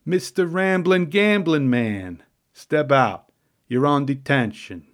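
hammed-up tough-guy lunch monitor ordering a kid out; gruff Mister Delia. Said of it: "I'm probably not doing this accent justice".

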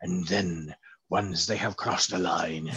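slow, low scheming villain; evil villain voice. Pretend. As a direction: gloat like a cartoon supervillain. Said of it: "excellent evil villain voice".

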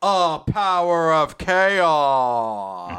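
loud, hugely drawn-out climax; dramatic movie line. Shown as a demonstration. Lake turns to camera for the climax, dramatic movie line. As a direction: stretch it slowly, playing to the camera. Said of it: "turn to camera".

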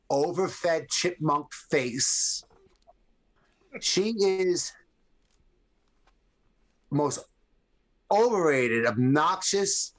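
drawn-out slow insult; slow emphatic. Real, not acted. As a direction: spell out each word slowly.

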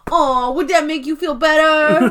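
high, loud mock-sweet jab; taunting. Pam taunting Michael over Toby's head, mock-sweet and loud. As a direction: needle him with fake concern. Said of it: "straight up taunting Michael".

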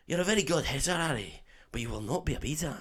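soft put-on Scottish accent; Oliver Wood. Quidditch keeper teaching Harry, hushed Scottish accent. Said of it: "for me to do a Scottish accent".

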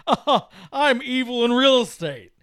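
slow, put-on evil businessman voice; cartoonish villain. Real estate guy revealing he is evil, hammy villain. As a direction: play an obvious movie villain, slowly. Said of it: "'cause the guy's like".

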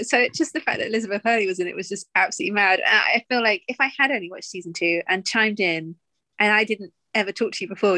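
usual speaking voice

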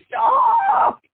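very high, drawn-out scream; imitated scream. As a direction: scream it like a panicked passenger in a speeding car. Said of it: "he screams".

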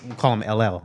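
usual speaking voice

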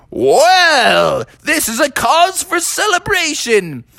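high, put-on celebratory creature voice; old wise rhinoceros. Old rhinoceros congratulating the golfers, jubilant. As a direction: proclaim it as a delighted old rhinoceros. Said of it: "the old wise rhinoceros".

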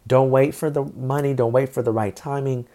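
usual speaking voice

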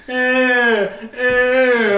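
high, loud mock grunts; mock bored grunting. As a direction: grunt half-heartedly on cue. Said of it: "going like".

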